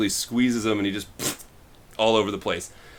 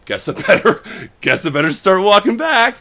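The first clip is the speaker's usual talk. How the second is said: laughing resignation; 8 semitones higher, 5 dB louder than usual. Retold or imitated. Friend retold giving up, laughing.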